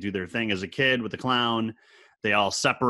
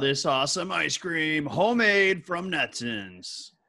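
high, hammed-up announcement, drawn out at the end; mock command; 6 semitones higher than usual. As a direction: playfully force it on the guests. Joins in mock force-feeding of guests.

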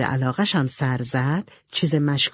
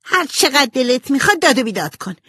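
high, loud defiant shout; angry shout; 9 semitones higher, 5 dB louder than usual. A narrator's reading. Amy shouts back at Jo that she burned the book, loud and defiant. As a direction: spit it back in triumph. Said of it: "ایمی هم عصبانی شد و داد زد".

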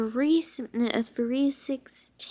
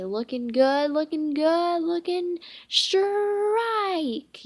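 rising louder and higher to a long stretched shout; announcer yelling a strike; building yell; 7 semitones higher, 6 dB louder, slower than usual. A narrator's reading. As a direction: build the hype to a peak. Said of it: "yelled the human bowling pin".

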